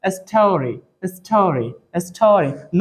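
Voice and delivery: स्टोरी said with the English retroflex st and no Hindi vowel break; English-style story. Shown as a demonstration. Teacher tells the class to say स्टोरी the English way. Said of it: "चलो, कैसे बोलना है?".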